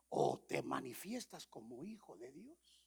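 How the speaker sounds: ordinary delivery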